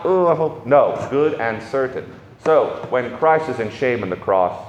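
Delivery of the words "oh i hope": mock wishful hope.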